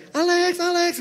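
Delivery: high, drawn-out call; imitated calling out. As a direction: imitate a woman calling for Alex. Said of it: "bodo amat lu teriak gitu ya".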